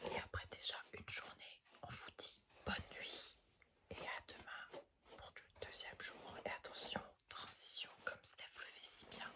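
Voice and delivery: soft whispered goodnight; whispering. Acted. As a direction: hush it like a bedtime sign-off. Whispered sign-off to viewers.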